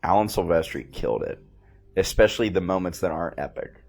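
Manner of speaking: ordinary talk, nothing marked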